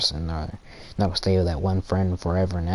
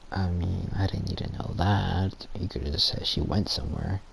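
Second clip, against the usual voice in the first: muttered aside; 7 dB quieter than usual. Retold or imitated. Retold reply to oversharing boyfriend.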